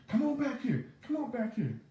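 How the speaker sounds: high mock shout, calling him back; mimicking the lady. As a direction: imitate her beckoning him behind the counter, pitched high. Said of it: "And she's been".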